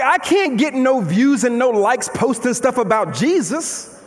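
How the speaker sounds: usual speaking voice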